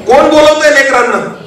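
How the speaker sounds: high-pitched, insistent sermon delivery. Acted. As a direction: preach it high and sustained.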